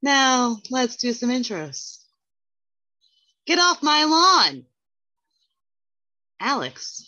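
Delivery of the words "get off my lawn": put-on yelling old man, higher and louder; grumpy old man. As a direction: shout like a cranky old man. Joke intro as grumpy elder.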